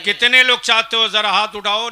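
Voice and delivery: loud, high call to the crowd; rallying call. Real, not acted. Preacher asking who wants cheaper weddings, calling out loudly. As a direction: get every hand up. Calls crowd to raise hands.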